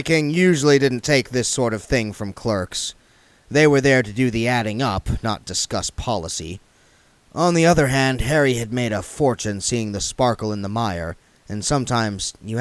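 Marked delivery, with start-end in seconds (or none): none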